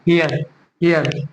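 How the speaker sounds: हियर said slowly, twice; teacher repeats हियर slowly for learners; slow model word. Shown as a demonstration. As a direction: demonstrate हियर unhurried, like teaching a class. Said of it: "pronunciation क्या होगा?".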